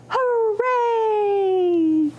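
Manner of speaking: high, bright cheer; cheerful hooray. Shown as a demonstration. Librarian cheers hooray with children, high and joyful. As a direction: whoop it up for the kids. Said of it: "shout hooray".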